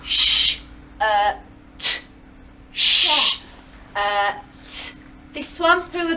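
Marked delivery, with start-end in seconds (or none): 0.0-2.0 robot voice. Shown as a demonstration. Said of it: "say it in a robot voice". 2.7-4.9 robot voice. Shown as a demonstration. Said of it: "say it in a robot voice".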